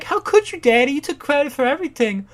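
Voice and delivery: high, loud mock indignation; outraged daughter, acted. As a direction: protest to Daddy in a shrieky put-on voice. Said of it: "And daughter's like".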